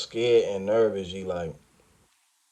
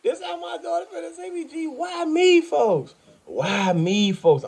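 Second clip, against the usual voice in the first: crying; slower than usual. Retold or imitated. Begging why me while crying, voice much higher. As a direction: voice cracking up into a desperate wail. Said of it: "I was crying".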